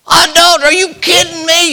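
very high, loud disbelief; incredulous. Retold or imitated. Preacher as judge hearing of adultery, shrill disbelief. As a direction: shriek at the idea of adultery.

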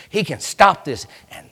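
ordinary delivery

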